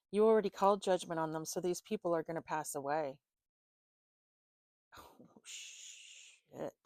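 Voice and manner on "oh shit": breathy shocked whisper; whispered oh shit. Real, not acted. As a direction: whisper it under your breath. Shocked reaction to reading.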